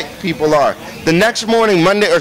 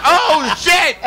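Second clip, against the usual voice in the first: much higher, sudden alarm; startled exclamation; 9 semitones higher than usual. Retold or imitated. Watching [name] grab [name], startled. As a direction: yelp it out in shock. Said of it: "sitting there going like".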